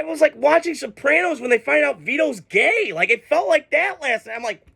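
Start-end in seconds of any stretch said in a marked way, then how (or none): none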